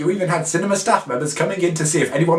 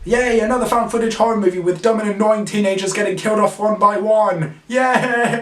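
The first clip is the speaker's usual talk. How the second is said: sarcastic, higher fake excitement; mock cheer; 5 semitones higher than usual. Reviewer faking glee about another found footage film.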